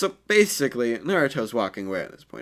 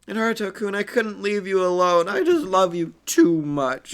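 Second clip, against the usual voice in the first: put-on high, slower lovestruck girl voice; shy Hinata; 8 semitones higher, 4 dB louder than usual. Hinata confessing her love to Naruto, high and slow. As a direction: sigh it out like a smitten girl.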